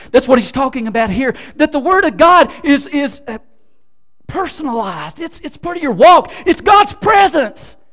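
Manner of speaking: loud, high, fervent preaching; full-force preaching. Real, not acted. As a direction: proclaim it to the whole room.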